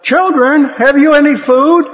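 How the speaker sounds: a voice calling to fishermen from the shore. A narrator's reading. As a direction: call out to the boat from the shore. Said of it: "the stranger called out".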